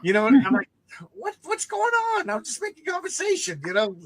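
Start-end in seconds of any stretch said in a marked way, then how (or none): 1.1-3.6 very high, squeaky-pitched protest; hammed-up high voice of helpless confusion; mock bewilderment. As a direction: imitate a baffled innocent, pitched way up. Bewildered protest in the retold story.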